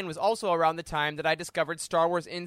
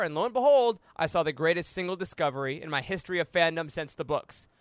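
usual speaking voice

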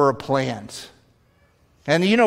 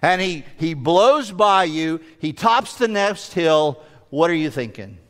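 ordinary talk

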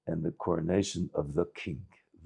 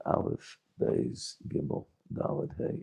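whispered recital; 6 semitones higher, 6 dB quieter, slower than usual. Acted.

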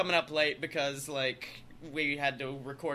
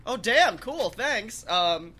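sarcastic thanks; 4 semitones higher, 7 dB louder, slower than usual. Retold or imitated. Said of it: "I was like".

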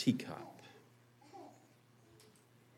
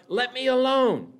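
high-pitched protest, only slightly louder; mild yell; 12 semitones higher, 3 dB louder than usual. A narrator's reading. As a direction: yell it out in a high voice, acting the teacup. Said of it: "I yelled out".

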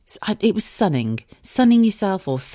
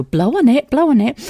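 higher, louder fussing mum; 8 semitones higher, 5 dB louder than usual. Retold or imitated. Mother telling her kids to blow on hot food, higher and louder. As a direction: fuss like a mum over a kid's hot dinner. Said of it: "when you're a mum, you go".

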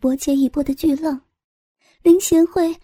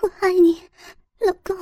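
higher, tearful female voice; rising into a cry as the woman; 6 semitones higher, much faster than usual. Acted. Woman confesses, crying out.

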